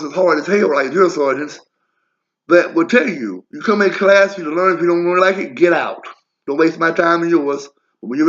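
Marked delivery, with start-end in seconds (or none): none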